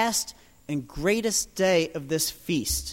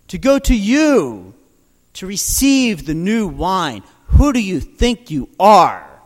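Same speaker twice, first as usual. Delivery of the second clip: loud, rising outrage; indignant challenge; 7 dB louder than usual. Retold or imitated. Priests challenging Jesus, loud and indignant. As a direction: hurl the accusation at him.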